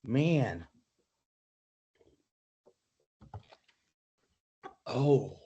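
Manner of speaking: quiet, strained from spicy heat; talking through a burning mouth; mouth burning from spicy food. Real, not acted. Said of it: "my whole mouth is burning".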